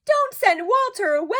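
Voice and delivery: put-on very high child voices, pleading; begging children. A narrator's reading. Children begging Father to keep Walter. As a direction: plead with Daddy like small kids. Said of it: "begged Betty and Billy".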